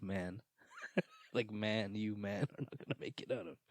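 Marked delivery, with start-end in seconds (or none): 1.5-3.6 soft, laughing through the words; laughing warning. Real, not acted. Podcast host laughing while warning his friend, softer. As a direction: chuckle as you repeat the warning.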